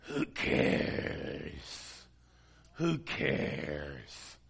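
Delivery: low, rasping whispered demon voice; low, voiceless demonic rasp. Retold or imitated. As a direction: growl it out like a possessing spirit. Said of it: "And this voice said".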